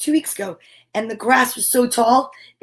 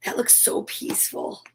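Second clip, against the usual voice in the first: hushed whisper, barely voiced; 10 dB quieter than usual. Real, not acted. Reader whispering over the beach picture. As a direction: breathe it out softly.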